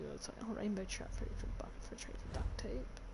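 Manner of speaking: hushed whisper. Pretend. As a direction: breathe it out unvoiced. Whispered mock trade offer.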